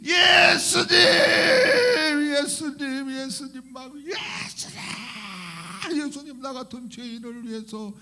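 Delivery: high, tearful cries swelling into a shout; imitated wailing. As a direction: act out a convert wailing to Jesus, voice pitched high. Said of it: "막 이런 통곡".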